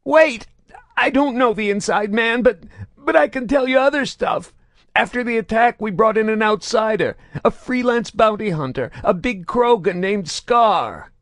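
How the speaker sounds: high, loud rushed confession; panicked outpouring. A narrator's reading. Grotto spills everything to stop the torture. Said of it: "the words began to pour out".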